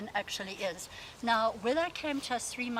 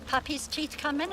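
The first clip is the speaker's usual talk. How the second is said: normal talking voice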